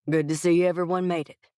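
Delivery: Texan boss addressing his team; Texas twang. A narrator's reading. CEO greeting his leadership team, Texas twang. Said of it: "his gentlemanly Texas twang".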